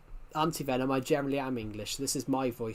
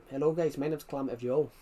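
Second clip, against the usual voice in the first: ordinary talk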